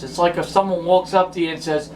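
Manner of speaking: ordinary talk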